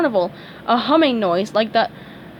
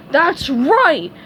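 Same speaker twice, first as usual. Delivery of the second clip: higher, loud retort; angry cry; 6 semitones higher, 6 dB louder than usual. A narrator's reading. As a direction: fire it back hard. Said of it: "Patrick cried".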